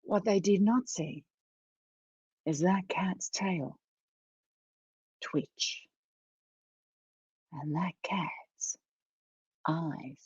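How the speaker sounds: hushed, slow, long pauses; suspenseful whisper. A narrator's reading. Storyteller reveals what the mice did not see, suspenseful whisper. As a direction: whisper it slowly so the danger creeps in. Secret danger as cat wakes.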